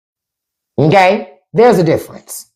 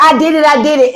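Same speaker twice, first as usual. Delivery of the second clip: put-on caving child; 7 semitones higher than usual. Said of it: "I'm like".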